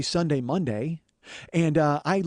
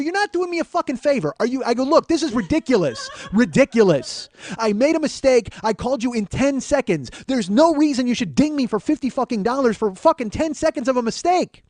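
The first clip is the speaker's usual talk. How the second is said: much higher, louder outraged tirade; furious rant; 9 semitones higher, 5 dB louder than usual. Retold or imitated. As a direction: blow up at the supervisor. Arguing with the supervisor.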